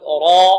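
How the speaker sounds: را with a thick, dark r, held loud; loud, sustained heavy را. Shown as a demonstration. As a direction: fill the mouth on a long را. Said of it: "تفخم إذا فتحت أو ضمت".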